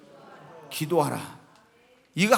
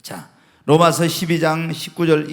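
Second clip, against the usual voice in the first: ordinary talk, nothing marked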